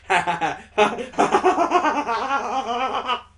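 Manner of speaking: acted cackle climbing high; manic laughter. Imitating her laughing like a complete psycho, manic laughter. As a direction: let the laughter climb and run on.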